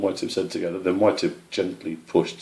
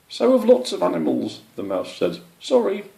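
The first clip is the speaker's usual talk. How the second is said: small mouse; 12 semitones higher, 4 dB louder than usual. Acted.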